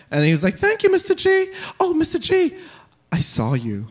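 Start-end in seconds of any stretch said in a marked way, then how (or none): 0.6-2.5 high-pitched imitation of a little kid; put-on high child voice; second grader. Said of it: "I was tying a second grader's shoes". 3.1-3.9 put-on lower, deadpan kid remark; imitated deadpan accusation. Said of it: "deadpan tone".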